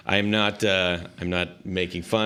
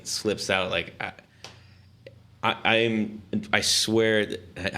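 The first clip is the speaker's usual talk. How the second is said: normal talking voice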